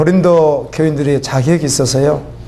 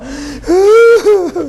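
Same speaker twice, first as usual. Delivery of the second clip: crying sob; 13 semitones higher, 3 dB louder than usual. Retold or imitated. Pastor recalls weeping over church.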